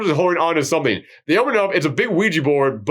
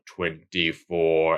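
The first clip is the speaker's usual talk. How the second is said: very low, slow, softer delivery; Creon voice; 12 semitones lower, 6 dB quieter, much slower than usual. Retold or imitated. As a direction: draw it out slowly in a deep, hushed voice. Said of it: "it comes to Creon".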